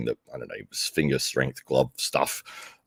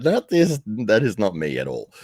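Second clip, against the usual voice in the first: emphatic rejection; 6 semitones higher, 3 dB louder than usual. Retold or imitated. Rejecting killer nicknames.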